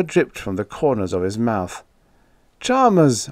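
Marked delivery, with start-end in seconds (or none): 2.6-3.3 high, loud shout of the name; sharp call, pitch raised; urgent cry. A narrator's reading. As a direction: cry out to stop someone. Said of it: "I cried".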